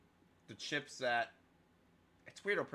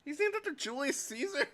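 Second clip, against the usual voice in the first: much higher, disbelieving question; incredulous. Real, not acted. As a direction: ask in utter disbelief. Shocked at mascot name.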